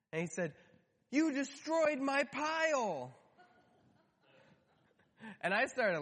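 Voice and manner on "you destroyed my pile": put-on high, slow outraged little-boy voice; angry toddler. Toddler Eli accusing his dad, high and outraged. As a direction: play a three-year-old wailing at his dad. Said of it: "his slight anger was real".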